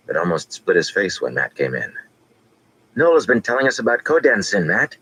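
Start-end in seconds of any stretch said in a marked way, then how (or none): 3.0-5.0 put-on high, eager boy's exclamation; bursting to share news; excited young boy talking over himself; exclaiming. A narrator's reading. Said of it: "he exclaimed".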